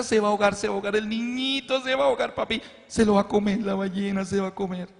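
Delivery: put-on frightened child. Daughter hiding her face from the whale, frightened whimper. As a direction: whimper out the fear.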